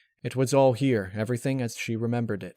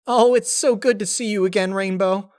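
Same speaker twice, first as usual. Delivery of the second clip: very high, loud, bubbly pony voice; Pinkie Pie voice; 10 semitones higher, 8 dB louder than usual. Acted. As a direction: gush with teary joy.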